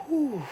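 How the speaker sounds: a long, relieved whew of breath out; demo exhale. Shown as a demonstration. Showing listeners how she breathes out when upset, exhaling slowly. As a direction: blow the breath out slowly to calm down. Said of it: "I'll start".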